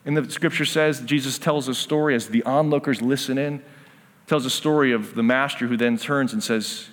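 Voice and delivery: usual speaking voice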